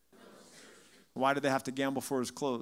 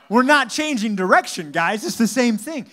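loud, high mock-exasperated shout; retold outburst, raised and punchy; exasperated retort; 6 semitones higher, 11 dB louder than usual. Retold exasperated reply to confused congregation.

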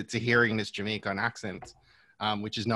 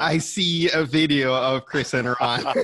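laughing tease; 4 semitones higher, 4 dB louder than usual. Real, not acted. Teasing about LinkedIn videos.

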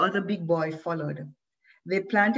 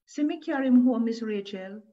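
put-on girl's voice; 5 semitones higher, 4 dB louder than usual. A narrator's reading. Girl speaking to Moshe's mother.

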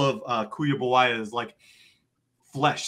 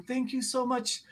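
put-on much higher, sleepy old lady voice, paybor for favor; tired grandma with accent; 10 semitones higher than usual. Lola thanking the party before going to sleep, high and tired. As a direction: mumble thanks like an exhausted grandmother. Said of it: "Lola is sitting there going".

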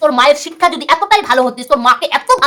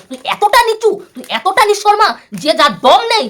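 higher, scornful and heated; contemptuous outburst; 7 semitones higher than usual. Real, not acted. Vlogger calling rival worthless, contemptuous and higher. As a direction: spit out the insult.

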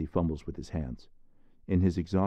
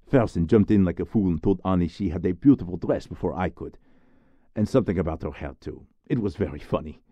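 scornful gossip; 6 dB louder, faster than usual. Acted. Boy retelling gossip, scornful.

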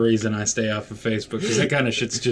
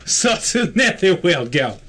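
higher, mock Russian voice; bad Russian accent; 6 semitones higher, 4 dB louder than usual. Player clowning at co-player about the netherworld, bad Russian accent. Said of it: "Bad Russian accent".

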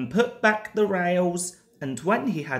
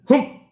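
loud humph; 7 semitones higher, 9 dB louder than usual. A narrator's reading. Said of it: "said the fat director".

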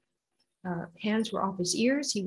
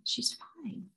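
quiet; 3 semitones higher, 9 dB quieter than usual. Retold or imitated. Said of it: "A few times during the pregnancy".

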